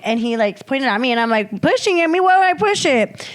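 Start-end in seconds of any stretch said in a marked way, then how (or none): 1.6-3.3 high-pitched mock-indignant denial; indignant protest. Accused player denying pushing the board, indignant. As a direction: push the voice right up in disbelief.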